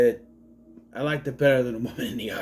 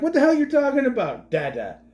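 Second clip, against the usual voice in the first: mock disbelief; 10 semitones higher, 4 dB louder than usual. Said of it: "I'd be like".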